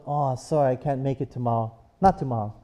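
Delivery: a hint of a boyfriend backing out, low and quiet; sheepish letdown. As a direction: let the voice drop with his face. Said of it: "his face drops and goes".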